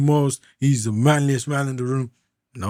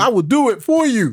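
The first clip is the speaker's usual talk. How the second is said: high, loud mock-indignant protest; emphatic insistence; 12 semitones higher, 8 dB louder than usual. Husband insisting to wife he can fix it, emphatic. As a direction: insist loudly, pitched way up.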